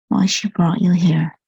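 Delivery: ordinary talk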